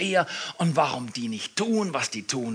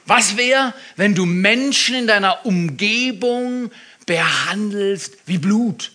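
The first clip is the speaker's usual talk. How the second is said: loud, higher, urgent appeal; preaching at full force; 7 semitones higher, 9 dB louder than usual. Acted. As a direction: rouse the congregation. (laute, eindringliche Predigt)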